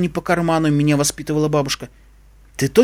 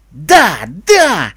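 energetic cry; 6 dB louder than usual. A narrator's reading. Pegasus picked for the expedition, crying out energetically. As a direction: cry out eagerly. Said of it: "энергично вскричала Пегаска".

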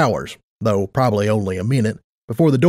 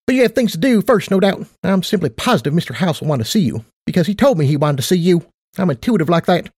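much higher, perky affectionate mare; perky Tight Lips voice; 5 semitones higher, faster than usual. Acted. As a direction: play a bubbly casino boss.

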